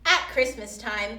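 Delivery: plain reading voice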